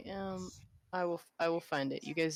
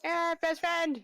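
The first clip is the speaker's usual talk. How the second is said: loud, high greeting; high, gleeful shouting; excited shout; 11 semitones higher, 6 dB louder than usual. Real, not acted. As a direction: holler happily to your best friend.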